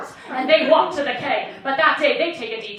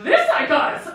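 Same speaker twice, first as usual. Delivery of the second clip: louder, smug, full of stink; smug sneer; 4 semitones higher, 6 dB louder than usual. Retold or imitated. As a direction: say it with audacity. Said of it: "he said it with so much stink".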